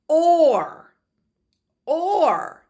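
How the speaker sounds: Or said high and loud, drawn out; teacher modeling Or; model sound or. Shown as a demonstration. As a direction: sing out Or clearly for the class. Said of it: "are you saying it?".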